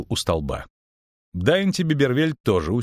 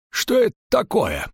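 high-pitched, startled question; innkeeper's high, alarmed voice; 13 semitones higher than usual. A narrator's reading. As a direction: ask in astonishment, pitched right up. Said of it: "спросил трактирщик".

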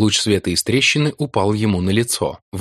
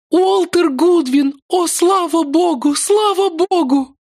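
high, slow tearful relief; young woman sobbing with relief; 4 dB louder, slower than usual. Acted. Ruth weeping with relief. (высоким голосом, сквозь слёзы)

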